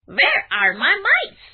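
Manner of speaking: hungry cat looking for its mice; cat asking. A narrator's reading. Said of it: "he would have asked".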